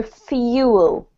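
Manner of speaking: fuel said with a clear y glide, fyoo-ul; y sound in fuel. Shown as a demonstration. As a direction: slide a y in before the u of fuel. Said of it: "get the y sound into it".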